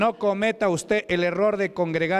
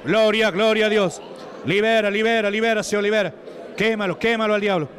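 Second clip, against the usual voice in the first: full-force exorcism preaching; 4 semitones higher than usual. Real, not acted. Preacher praising God as the possessed come forward, full-force chanting. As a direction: drive each praise out forcefully.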